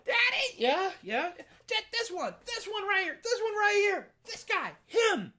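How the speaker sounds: high, loud, delighted exclamations; overjoyed sheriff. Acted. Sheriff to Jack, overjoyed his daughter laughs, loud and high. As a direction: burst out with delight.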